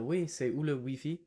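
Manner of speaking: put-on a light French-from-France accent, lifted at the end; understated French accent asking for the Wi-Fi. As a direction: sound elegant and Parisian, lightly. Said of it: "in French from France".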